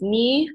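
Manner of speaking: "ni" held high and level, first tone. Shown as a demonstration. Teaching Mandarin tones to listeners, high level first tone. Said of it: "first tone, which is".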